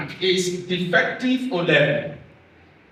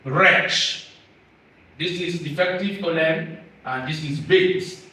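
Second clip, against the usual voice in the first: ordinary delivery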